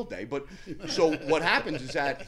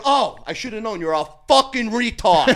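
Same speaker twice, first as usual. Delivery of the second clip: higher, louder scornful jab; mocking insult; 7 semitones higher, 10 dB louder than usual. Insulting the frightened guy at the gym, loud sneer. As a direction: sneer it at him. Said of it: "I said".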